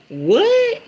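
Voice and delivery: sudden high squeak of disbelief; a sharply risen "What?" in mock shock; surprised what. Real, not acted. Mock disbelief at mom's reaction.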